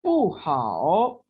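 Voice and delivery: पू हाओ stretched, हाओ dipping; careful drill reading; slow tone demo. Shown as a demonstration. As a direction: model पू हाओ syllable by syllable. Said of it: "बू की tone change नहीं हुई".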